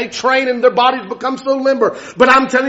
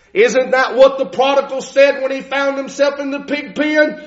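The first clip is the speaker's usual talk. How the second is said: usual speaking voice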